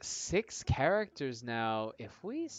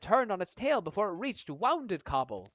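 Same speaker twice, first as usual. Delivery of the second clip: higher-pitched would-be hero boasting of his family; eager storyteller character; 5 semitones higher, 6 dB louder than usual. Acted. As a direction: spin a proud family legend in character.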